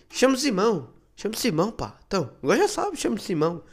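high, loud pushy guy repeating his name; insistent acquaintance. Retold or imitated.

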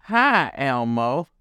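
lightly voiced dad, lifted pitch; storybook daddy. Daddy invites Elmo to decorate, lightly acted dad voice. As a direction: greet the child as a hearty father. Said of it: "asked Daddy".

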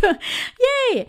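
high squeal of joy, imitating herself; mock-thrilled little cheer; excited yay. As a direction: squeal it like you just got the job. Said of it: "I was like".

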